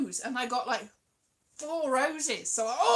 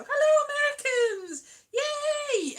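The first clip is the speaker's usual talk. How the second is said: high, loud cheery hello; excited greeting; 14 semitones higher, 5 dB louder, slower than usual. Real, not acted. Greeting American viewers with a high excited cheer. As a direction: greet them like long-lost friends.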